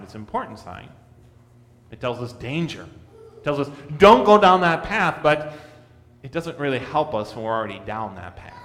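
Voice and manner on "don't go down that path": loud, high warning call; a loud cautionary cry; stern warning. Retold or imitated. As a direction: warn them off the path sharply. Sign warning against sin.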